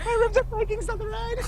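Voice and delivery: very high, whiny imitation of a hurting rider; mock pained plea. Mimicking the girl begging on the ride, high pained squeal. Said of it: "It was a girl every time".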